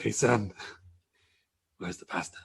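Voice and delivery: hushed whispered father; a dad whispering to his son backstage; Rey Mysterio whisper. Retold or imitated. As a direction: drop to a breathy whisper on the question. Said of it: "That sounds more like him".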